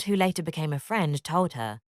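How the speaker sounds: ordinary delivery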